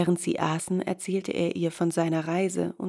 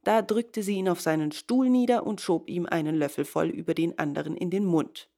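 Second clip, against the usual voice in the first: usual speaking voice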